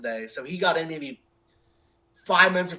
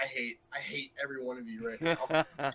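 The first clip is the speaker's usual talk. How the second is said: soft, fast, teasing grumble; mock grumble; 4 semitones lower, 10 dB quieter, faster than usual. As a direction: mutter it fast under your breath to friends.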